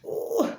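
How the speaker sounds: a very high, impressed ooh; high admiring ooh. Real, not acted. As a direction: coo at your own muscles. Admiring her own muscles.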